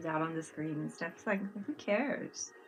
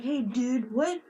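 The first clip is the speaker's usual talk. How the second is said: higher, louder affronted challenge; hammed-up outraged stranger; mock offended; 8 semitones higher, 6 dB louder than usual. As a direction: play someone taking offense at an insult. Offended people confronting the friend.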